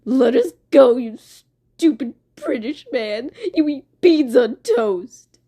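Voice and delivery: loud, put-on angry demand; slow, strained mock insult; Blood Moon. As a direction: rage helplessly at your captor, hammed-up. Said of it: "Blood Moon's just like".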